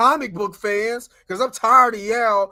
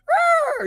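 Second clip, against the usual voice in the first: shrill high-pitched phoenix cry; bird screech. Retold or imitated. Friends recapping a movie, mock phoenix screech. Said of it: "she made the bird sound".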